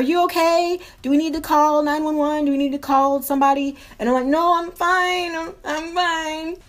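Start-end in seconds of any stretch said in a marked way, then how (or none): 0.0-3.8 higher-pitched worried calling; concerned bystander. Retold or imitated. Someone knocking on the bathroom door, worried. 4.3-6.6 high, slow, drawn-out reassurance; mock pitiful. Sick woman answering through the bathroom door, mock pitiful.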